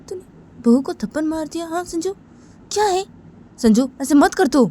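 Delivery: acted outburst of parental outrage; angry scolding.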